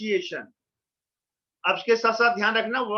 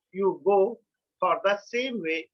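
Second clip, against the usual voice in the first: ordinary delivery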